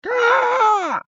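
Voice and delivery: very high screeching squawk; bird squawk. Retold or imitated. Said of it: "starts squawking. It's like".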